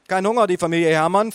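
sing-song carer question; nurse voice. A narrator's reading.

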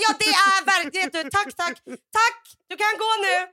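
high, loud over-insistent thanks; imitating panicked, high-pitched politeness; panicked dismissal. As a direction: act out flustered shooing of a waiter. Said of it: "då får jag sån panik".

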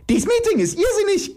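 very high-pitched shriek. Acted. Iris condemning the meeting, shrieking. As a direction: screech it shrilly. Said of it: "gilft Iris".

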